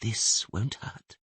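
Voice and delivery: soft, quiet coaxing murmur; gentle soothing voice. Acted. Kneeling stranger coaxing a dying man to talk, softly. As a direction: lull him while pressing for names. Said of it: "said the gentle voice".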